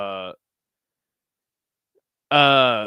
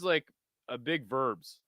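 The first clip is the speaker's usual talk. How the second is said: normal talking voice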